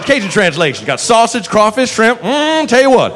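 a big easygoing New Orleans cop greeting the bar; jovial Southern cop. Retold or imitated. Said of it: "proceeds to give us tourist tips".

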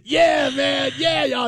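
high, hyped-up answering shout; excited reply. Retold or imitated. Host answering a fist-bumping stranger, hyped shout. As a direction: answer the fan, all pumped up. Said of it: "I'm like".

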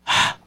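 short soft exhaled "ha"; breath puff. Retold or imitated. Son breathes out for his father's cerveza check, breath puff. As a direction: huff out a breath to be sniffed. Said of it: "hacía yo".

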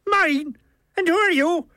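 high Irish character challenge; indignant Milligan. A narrator's reading. Milligan confronts the unseen author, high and indignant.

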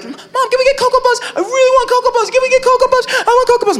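put-on high, pleading child voice; whiny kid brother. Brother begging mom at the store, high whiny pleading. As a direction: beg like a little kid in the aisle. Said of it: "my brother would be like".

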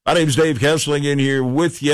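upbeat show intro voice; radio announcer. Acted. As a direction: open the show like a DJ.

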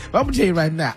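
ordinary talk, nothing marked

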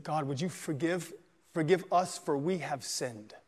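soft, slow plea for forgiveness; a hushed confession to God; hushed prayer. A narrator's reading. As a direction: pray it quietly and slowly, head bowed. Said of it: "And he said".

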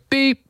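high spoken "Beep" faking the tone; mock beep. Prankster faking the answering machine tone, high and spoken. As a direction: squeak out a fake machine beep. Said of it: "and then you'd go".

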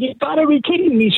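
high-pitched mock scream of disbelief; imitating a shocked high yelp; retold scream.

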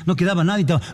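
ordinary talk, nothing marked

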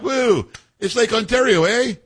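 high, hammed-up Canadian accent with a drawn-out "Woo" and a tagged-on "eh"; mock a Canadian politician proudly showing off a lake sign, in an exaggerated Canadian accent; Ford's Canadian accent. Host mocking Ontario's premier for his lake sign, high exaggerated Canadian accent. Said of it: "a spot-on impersonation of this guy Ford".

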